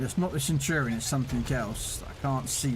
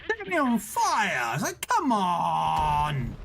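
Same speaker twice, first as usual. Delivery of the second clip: frustrated outburst; 6 semitones higher, 4 dB louder, much slower than usual. Real, not acted. Game tank sets him on fire.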